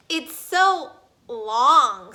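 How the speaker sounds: loud, slow drawn-out jeer; mocking tease. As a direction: tease her name. Child teasing, very loud slow.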